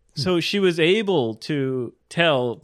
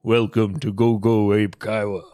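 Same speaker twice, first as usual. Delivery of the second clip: a slightly deeper put-on voice; practising a deeper radio voice; trying a deeper voice; 3 semitones lower than usual. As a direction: try to sound deeper. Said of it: "speak in a more deep voice".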